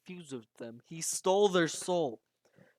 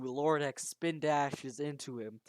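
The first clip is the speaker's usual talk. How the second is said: ordinary delivery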